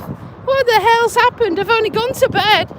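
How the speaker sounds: high-pitched, acted-out bewilderment; baffled disbelief. Waking up aching and questioning oneself, mock-baffled. Acts out baffled morning thought.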